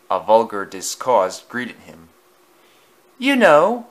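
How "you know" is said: much higher and louder, bragging; a show-off engine boasting to his friend. A narrator's reading. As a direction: crow it across the shed. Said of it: "boasted loudly".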